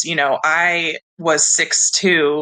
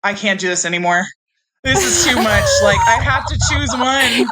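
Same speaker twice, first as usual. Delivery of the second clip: mock sobbing; 6 semitones higher than usual. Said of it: "start bawling".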